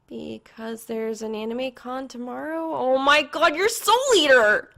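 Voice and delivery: put-on girlish excited squeal; excited recognition. A narrator's reading. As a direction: voice shoots up, loud and thrilled. Excited recognition of friend's costume.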